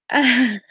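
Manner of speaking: short high-pitched laugh. Real, not acted. Laughing at the live chat, short high laugh.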